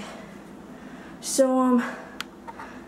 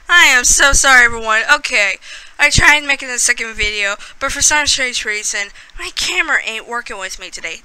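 normal talking voice

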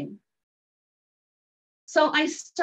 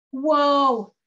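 loud, higher, drawn-out amazement; amazed reaction; 4 semitones higher, 6 dB louder than usual. Retold or imitated. Woman imitating man amazed by her demonstration, awed. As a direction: imitate someone mildly awestruck.

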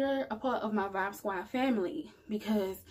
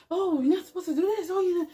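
high, loud mocking voice of a scolding critic; mock preachy scold; 8 semitones higher, 6 dB louder, faster than usual. Mocking the people who say what's not allowed, in a high loud mock scold. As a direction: sneer it like a judgy know-it-all.

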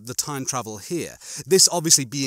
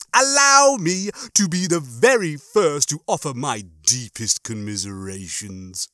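smug, mock-solemn condolences; Rimmer; 3 semitones higher than usual. Rimmer offers Lister his deepest commiserations, smugly.

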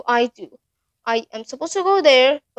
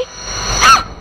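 breathy shriek shooting very high; terrified scream; 4 dB louder than usual. Real, not acted. Gamer with the clown behind him, shrieking. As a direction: shriek in pure fright.

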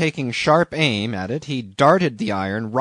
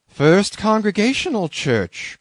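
high-pitched exclamation of disbelief; incredulous cry; 9 semitones higher, 4 dB louder than usual. A narrator's reading. Captain doubting the harpooner is a church member, incredulous cry. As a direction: throw the name back, scandalised. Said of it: "cried Bildad".

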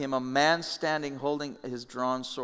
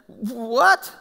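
much higher, louder indignant cry; indignant outburst; 11 dB louder than usual. Retold or imitated. The preacher acts his own shocked protest to God, shouting high. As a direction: protest to God at full volume. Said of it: "My response would have been".

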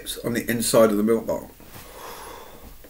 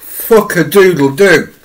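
slow, louder pained cry; loud drawn-out exclamation; 5 semitones higher, 9 dB louder, slower than usual. Real, not acted. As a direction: bellow it out in pain.

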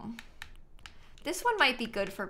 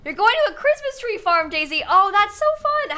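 high, loud, gushing delight; excited; 10 semitones higher, 8 dB louder than usual. Real, not acted. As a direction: squeal with excitement for her. Excited for a villager's trip.

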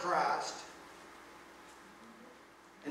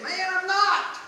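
much higher and louder protest; emphatic denial; 11 semitones higher, 11 dB louder, slower than usual. Retold or imitated. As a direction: fire it back at the accuser. Peter's second denial, acted.

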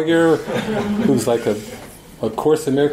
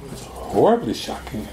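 hushed; 7 dB quieter than usual. Retold or imitated. Mike telling the preacher about his wife leaving, hushed. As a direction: drop to a stunned hush. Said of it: "He said".

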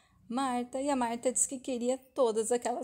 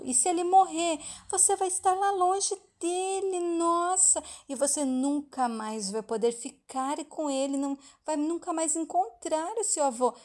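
higher, louder acted child's warning; hammed-up what-if, pitched up; alarming warning; 6 semitones higher, 4 dB louder than usual. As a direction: dramatise it to frighten a little friend. Child scaring friend about grandfather dying.

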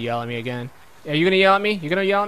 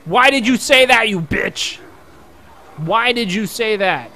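loud, higher indignant yelling; mock outrage; 5 semitones higher, 8 dB louder, slower than usual. Gamer scolding a character who snitched, mock outrage. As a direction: tell off the snitch in hammed fury.

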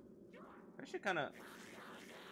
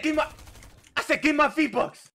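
demanding shout; 12 semitones higher, 7 dB louder than usual. Pretend. Repeats demand, louder.

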